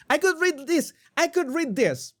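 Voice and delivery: high-pitched, emphatic outburst; excited exclamation. Real, not acted. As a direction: exclaim it with voice shooting up. Exclaiming over the statement's wording.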